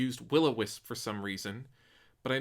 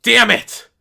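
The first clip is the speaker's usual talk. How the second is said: loud frustrated curse; angry shout; 10 semitones higher, 16 dB louder than usual. Acted. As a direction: spit out the curse. Loses the round.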